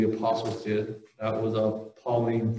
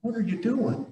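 higher pitched prophet, scolding the people for missing the point; a pitched-up, incredulous rebuke; exasperated Isaiah; 7 semitones higher than usual. Retold or imitated. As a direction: play Isaiah throwing up his hands at the foolish crowd. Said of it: "Isaiah ended up having to come ... and be".

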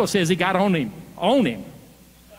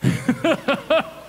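high chuckle; real burst of laughter, pitched up; amused laugh; 8 semitones higher than usual. Real, not acted. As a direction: laugh at the answer you got. Laughs at the congregation's answer.

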